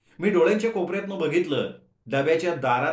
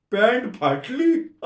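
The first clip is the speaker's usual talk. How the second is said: higher, louder, slow wail of embarrassment; embarrassed confession; 9 semitones higher, 6 dB louder, much slower than usual. A narrator's reading. Narrator confessing to roommate, a dismayed higher wail. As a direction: blurt out the mishap, dismayed.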